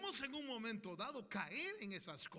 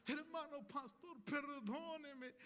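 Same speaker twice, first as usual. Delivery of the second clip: mock apology; 6 semitones higher, slower than usual. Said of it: "estoy arrepentido de venir tarde".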